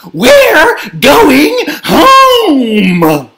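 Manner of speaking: very slow, high, loud announcement; screaming. A narrator's reading. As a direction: scream it out, stretching each word. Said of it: "screamed Gabby's mom".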